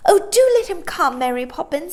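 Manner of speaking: put-on high, loud coaxing girl's voice; pleading girl. Sister pleading with the nanny for her brother. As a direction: coax the nanny on his behalf. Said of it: "said Jane".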